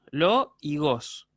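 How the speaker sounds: "lo y gos", each syllable slow and separate; teacher separating "lo" from "gos". Shown as a demonstration. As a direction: split logos into a drawn-out "lo" and "gos". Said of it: "una palabra que tiene dos sílabas".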